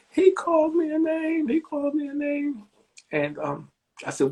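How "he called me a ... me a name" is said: put-on whining child. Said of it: "Oh, stop crying".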